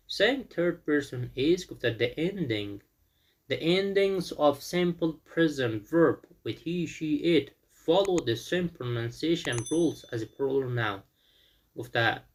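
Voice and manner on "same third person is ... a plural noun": slow, careful reading of the rule. Shown as a demonstration. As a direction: dictate it slowly to students. Teacher reads rule from book.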